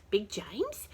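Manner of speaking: plain reading voice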